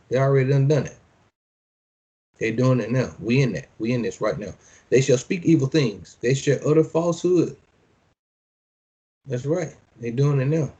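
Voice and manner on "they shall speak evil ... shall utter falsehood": measured preacher's reading; scripture reading. Acted. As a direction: declaim each line like a decree. Reads scripture aloud.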